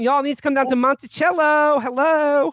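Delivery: sashay the words up high and playful. Pretend. Old president imagined with a camp voice, high and sing-song.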